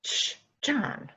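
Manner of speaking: whispered hush. Acted. Woman silences John beside the body, whispered hush. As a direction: whisper it, hushing him.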